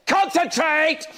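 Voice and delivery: slow, high mock bark of concentrate; retold dad's snap. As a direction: imitate dad snapping at the stall. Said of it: "and he said".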